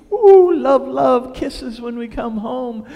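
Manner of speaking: high, gushing newlywed bliss; hammed-up honeymoon swooning; mock lovey-dovey gushing. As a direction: coo it loud and high, sending up romance. Mocking the ideal happy marriage.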